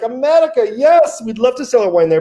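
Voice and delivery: high, excited exclamation; imitated eager delight. French winemakers asked about selling in America, imitated excited eagerness. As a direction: leap up in pitch with mock enthusiasm.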